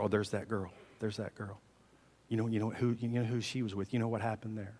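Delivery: soft, low gossip; gossiping whisper. Acted. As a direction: hush it like neighbours pointing. Townspeople gossip about her.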